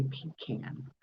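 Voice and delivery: pecan said "PEE-can", like pee in a can; "pecan" with a long EE and a short A as in can; pronunciation PEE-can. Shown as a demonstration. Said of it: "sounds like you are peeing".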